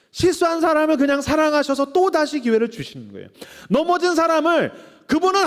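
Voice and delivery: loud, fervent preaching climax on God's grace; preaching at full force. Acted. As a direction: pour it out, rising and pressing. (은혜를 외치는 뜨거운 설교)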